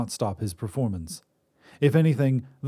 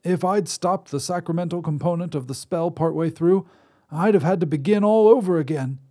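put-on higher reedy old man's voice; reedy old wizard; 7 semitones higher, 4 dB louder than usual. Wizard voice held.

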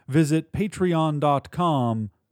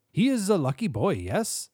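quick, high German-Polish singsong; chirpy Central European accent with a tag question; high accented voice, swooping German or Polish tune; 7 semitones higher than usual. A narrator's reading. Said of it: "the accent German or Polish".

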